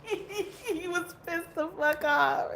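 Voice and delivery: high, drawn-out words through real laughter; laughing. Real, not acted. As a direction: tell it cracking up, slowly. Laughing while recalling the dog.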